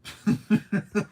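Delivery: high breathy burst of laughing; real high-pitched laughing fit; high laughter. Real, not acted. Laughing at a profile picture.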